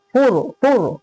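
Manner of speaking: फुर with a thick r after "u"; heavy ra. Shown as a demonstration. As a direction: make the र closing फुर full and heavy. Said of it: "रा साकिन जिससे पहले पेश है".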